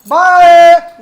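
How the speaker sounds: long, loud stretched "bye"; sign-off shout. Real, not acted. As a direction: call a drawn-out goodbye to the viewers. Vlog sign-off called out to viewers. (ज़ोर से खींचकर विदाई)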